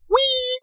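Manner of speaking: high squealing "wee"; mock squeal. Doctor skidding round the corner, high squeal. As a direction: squeal like sliding shoes.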